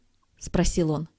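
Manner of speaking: plain reading voice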